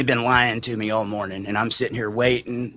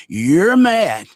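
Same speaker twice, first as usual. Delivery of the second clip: angry; 5 semitones higher, 7 dB louder than usual. Retold or imitated. Confronting Larry in retold quarrel.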